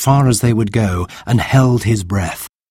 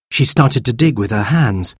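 ordinary delivery

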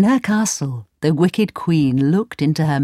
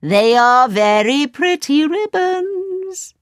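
high, slow crone calling her wares; the queen in disguise crying ribbons for sale; disguised old pedlar; 10 semitones higher, slower than usual. Acted. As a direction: lure the girl like a sweet old hawker. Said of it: "she cried".

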